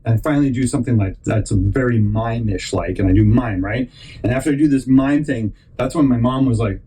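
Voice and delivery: normal talking voice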